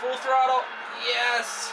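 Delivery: floor it and shout; excited shout. Real, not acted. Gamer shouts as he floors the throttle. Pushing car hard.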